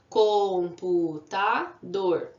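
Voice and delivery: "Computador" said very slowly, syllable by syllable; syllables drawn out. Shown as a demonstration. Teacher slowly counting syllables of computador for kids. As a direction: spell out "Computador" in four beats. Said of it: "Tem quatro".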